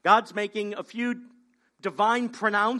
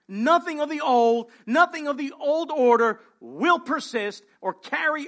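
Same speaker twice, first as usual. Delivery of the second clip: emphatic preaching; 5 semitones higher, 6 dB louder than usual. Acted. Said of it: "preacher emphasis".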